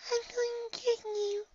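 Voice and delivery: high, soft, drawn-out threat; small mock yell. A narrator's reading. Shy pony warning snowball-throwing friend, tiny yell. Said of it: "she yells".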